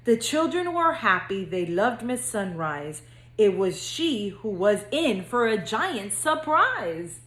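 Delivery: lively singsong storybook reading; reading to children. A narrator's reading. Counselor reading to future kindergartners, bouncy storybook narration. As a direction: read it like bedtime story time for little kids. Reads picture book to kindergartners.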